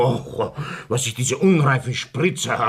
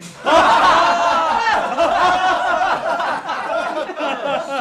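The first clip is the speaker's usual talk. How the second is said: loud mocking laughter; 5 dB louder than usual. Crowd laughs at speaker.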